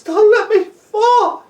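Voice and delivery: high, slow, wailing plea; whimpering beg, words stretched out; frightened pleading. Acted. Terrified boy keeps begging.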